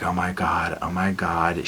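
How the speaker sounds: whispered, tense breath; frightened whisper. Retold or imitated. Viewer watching her crawl toward the stalker, whispering. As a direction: hold your breath and whisper it.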